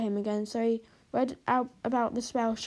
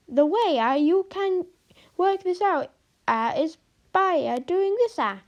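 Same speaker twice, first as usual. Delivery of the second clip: Italian teacher voice; 7 semitones higher, 6 dB louder than usual. Acted.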